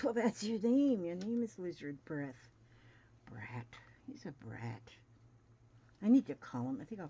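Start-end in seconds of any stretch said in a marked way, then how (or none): 0.0-2.5 higher, louder teasing big-brother voice; imitating her teasing brother. Brother greeting her by her old nickname, higher and louder teasing. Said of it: "He goes". 3.3-4.8 lower, softer muttered aside; whispered grumble. Real, not acted. Sister grumbling about her teasing brother, whispered.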